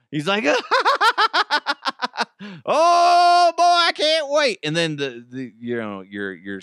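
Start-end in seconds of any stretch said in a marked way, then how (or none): 0.5-2.6 high, manic put-on cackle; weird laugh. Officer Kyle thrilled to shoot drones, weird laugh. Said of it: "that weird laugh". 2.6-4.6 very high, loud goofy glee; giddy excitement. Acted. Officer Kyle eager to shoot drones, giddy squeal. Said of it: "that weird laugh".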